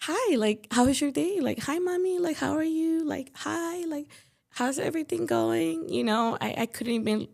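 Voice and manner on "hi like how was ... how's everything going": higher, bright-on-purpose hello to mom over a heavy day; forced cheerful greeting. Retold or imitated. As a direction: put on a brave happy face for mommy. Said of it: "walk in through the door and be like".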